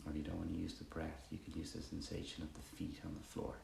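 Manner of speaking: soft, unhurried meditation guidance; guided meditation. Acted. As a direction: guide them gently through body and breath, pausing between phrases.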